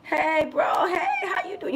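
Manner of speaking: hey bro shouted high and happy; excited greeting. Retold or imitated. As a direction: call out like you spotted an old friend. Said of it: "like, say".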